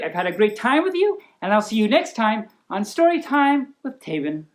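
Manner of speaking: plain reading voice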